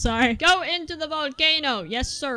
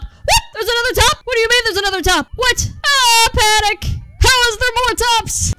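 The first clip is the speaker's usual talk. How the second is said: high, loud incredulous outburst; panicked disbelief; 7 semitones higher, 5 dB louder than usual. Real, not acted. Gamer finding there's another top, high panicked disbelief. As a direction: exclaim in mounting alarm.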